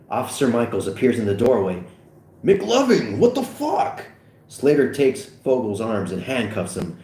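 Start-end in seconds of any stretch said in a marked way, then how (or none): 2.4-4.1 high incredulous outburst; astonished high exclamation; shocked disbelief. Acted. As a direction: exclaim in disbelief, pitch climbing. Second officer shocked at scene.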